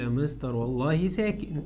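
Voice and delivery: low, soft imitation of a pupil; student answering. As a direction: lightly play a student answering quietly. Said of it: "هتقول لي".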